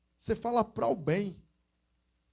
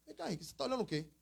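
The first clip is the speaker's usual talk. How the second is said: mannish woman; 4 semitones higher, 10 dB quieter, much faster than usual. Retold or imitated. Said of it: "ela tinha um jeito meio de homem".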